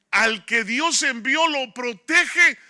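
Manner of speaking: preacher promising God's protection, booming and slow; preaching at full force. Real, not acted. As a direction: thunder it out slowly to the congregation. Preacher's climactic declaration.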